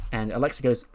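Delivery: ordinary delivery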